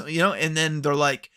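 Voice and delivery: ordinary talk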